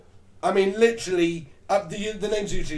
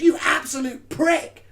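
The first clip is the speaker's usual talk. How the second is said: higher and slow, drawn-out scorn; indignant retort; 7 semitones higher, slower than usual. Retold or imitated. Offended woman cursing her date, slow scorn. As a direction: spit the insult out slowly. Woman insults man at station.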